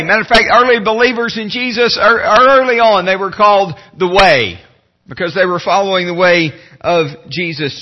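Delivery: ordinary talk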